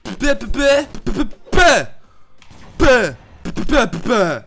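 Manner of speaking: loud, high sputter of buh sounds; frustrated sputtering buh. Real, not acted. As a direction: blubber in frustration. Sputtering noises while losing.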